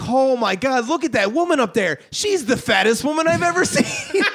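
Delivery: imitating a loud dad gawking in public. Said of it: "really loud".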